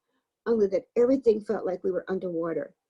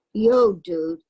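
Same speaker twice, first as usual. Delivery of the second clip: new voice; 7 dB louder than usual. A narrator's reading. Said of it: "said a new voice behind us".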